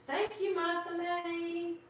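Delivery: high, slow, lightly voiced Santa; a gentle thank-you from a lightly played Santa. Santa thanking Martha May, high and slow. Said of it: "And Santa says".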